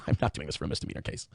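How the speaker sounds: quick amused half-whisper through a chuckle; brushing it off with a smirk; chuckling smirk. Retold or imitated. Judge brushes off prosecutor's request, chuckling half-whisper. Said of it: "she kinda did that chuckle smirk".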